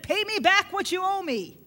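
a servant choking his debtor for money; demanding. Retold or imitated.

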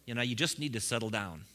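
a weary voice pouring cold water; jaded people telling new believers to settle down; disappointed naysayer. Retold or imitated. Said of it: "they'll go".

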